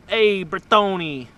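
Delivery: hammed-up Italian accent call. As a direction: hail the bike like an Italian pal. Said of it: "do the Italian accent".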